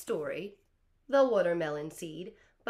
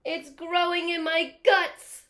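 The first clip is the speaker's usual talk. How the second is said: high, loud, slow panicked cry; panicked crocodile shout; 10 semitones higher, 13 dB louder than usual. Acted. Teacher voicing the crocodile to the class, panicked shouting. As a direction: wail it out like the croc is terrified. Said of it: "the crocodile is trying to get our attention".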